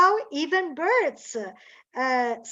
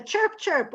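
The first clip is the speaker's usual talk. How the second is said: light chirps; 6 semitones higher than usual. Shown as a demonstration.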